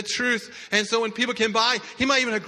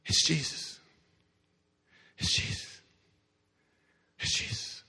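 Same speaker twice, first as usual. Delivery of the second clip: breathy hushed murmur; whispered rumor; 6 semitones lower, 8 dB quieter than usual. Acted. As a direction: pass the news in a whisper. Crowd murmuring news.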